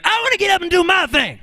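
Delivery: very high call as the band member; high funk sideman's holler; band member's shout. Retold or imitated. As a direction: yelp it high like the backing bandsman. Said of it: "that last voice was not [name]. This was the person in his band".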